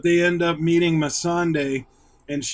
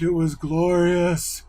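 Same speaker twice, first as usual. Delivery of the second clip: ordinary delivery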